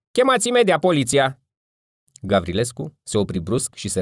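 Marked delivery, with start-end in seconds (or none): 0.1-1.4 much higher, louder shrill cry; woman at a window shrieking for the police; shrill woman's voice. A narrator's reading. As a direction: screech it like an alarmed woman. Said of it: "o voce ascuțită de femeie".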